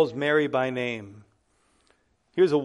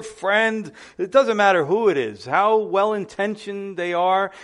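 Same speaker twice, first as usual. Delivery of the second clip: raised, higher preaching voice pressing every need; fervent preaching; 5 semitones higher, 5 dB louder than usual. Acted. Pastor urging the church to read the Bible, fervent preaching. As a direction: preach it out to the congregation with fire.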